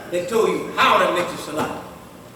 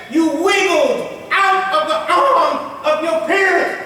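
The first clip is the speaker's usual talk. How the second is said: preaching build; 7 semitones higher, slower than usual. Real, not acted. Preacher building an example to a peak.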